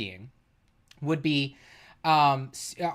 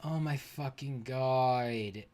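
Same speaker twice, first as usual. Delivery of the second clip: mock anguish; 5 semitones lower, 6 dB quieter, slower than usual. Real, not acted. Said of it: "it's all coming back to me".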